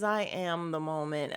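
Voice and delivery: low, soft, unbothered retort; calm. Retold or imitated. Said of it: "the whole time she's calm".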